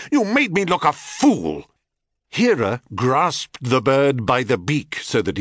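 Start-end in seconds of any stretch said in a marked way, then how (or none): 0.1-1.7 higher, outraged cry; furious shriek. A narrator's reading. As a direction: scream out the humiliation. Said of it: "she shrieked".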